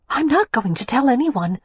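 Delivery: lightly voiced young woman, a bit breathless; a hint of breathless reassurance. A narrator's reading. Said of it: "Daisy said breathlessly".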